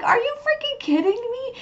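hammed-up squeal of disbelief, very high; outraged disbelief. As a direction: shriek it in mock outrage, way up high. Man hears he's laid off.

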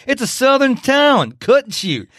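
Southern accent. Shown as a demonstration. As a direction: lay on the Southern accent with relish. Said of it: "say it with a Southern accent".

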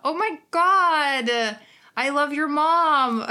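slow, high and loud delight. Real, not acted. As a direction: gush over the compliment. Delighted by a comment.

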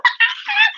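high squeal, half laughing; exultant scream. Retold or imitated. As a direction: re-enact a burst of pent-up energy. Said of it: "get out of my car and go".

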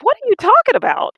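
incredulous. Retold or imitated. Retelling to the host, a high, loud disbelieving cry. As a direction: squeal it in disbelief at your friend. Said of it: "I was like".

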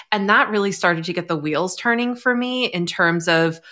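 ordinary talk

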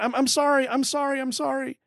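high, repeated pleading apology; acted high-pitched begging for forgiveness; cowering apology. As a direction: grovel in a high voice, fading off. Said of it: "it's kinda like saying".

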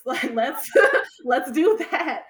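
loud, laughing agreement; real laugh bursting into the phrase. Real, not acted. Laughing while recalling her answer.

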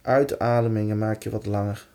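calm, unhurried meditation voice; guided meditation. Acted. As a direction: gently lead them into relaxation. Guides a body relaxation exercise.

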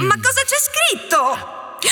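panicked. Acted. Panicked repeat of question.

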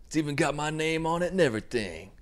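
high put-on gift-opening voice; imitated delighted recipient. Birthday man getting a Bible, high mock delight. Voicing Miles receiving a present.

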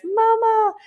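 put-on high-pitched toddler call; child's plaintive, high cry for mama; crying out for mom. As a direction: wail it like a kid calling for mom. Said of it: "looks at me and cries".

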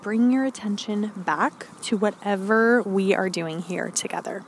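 normal talking voice

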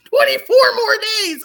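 high, loud laughing whine; hammed-up laughing lament; mock whining. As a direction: wail it like a dramatic complaint. Complaining about the challenge.

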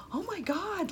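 high mock-shocked oh my God. Woman retelling her date's shocked reaction, high and startled. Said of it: "he was really shocked".

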